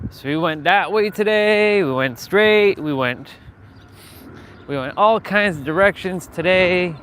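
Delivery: excited recap. Real, not acted. Telling viewers about the day's walk, loud and excited. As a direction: tell it pumped up, almost shouting.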